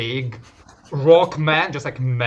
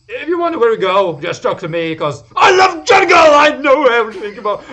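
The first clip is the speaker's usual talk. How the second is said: much higher, much louder fast shout; shouting enthusiast; 13 semitones higher, 13 dB louder, faster than usual. Acted. John bragging loudly that he is the navigator. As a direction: scream your love of jungle. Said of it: "and John goes".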